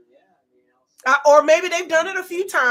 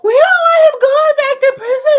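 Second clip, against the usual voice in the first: much higher, louder fake sobbing shout; mock wailing cry; 10 semitones higher, 11 dB louder than usual. Mimicking the neighbor's crying about prison, mock wail. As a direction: wail it like a melodramatic crier. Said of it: "why does she sound like that?".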